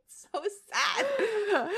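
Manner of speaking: slow, high, choked-up wail; real whimper of sorrow, drawn out; tearful. Real, not acted. Reader breaking off over the sad story, tearful.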